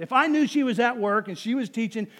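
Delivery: ordinary talk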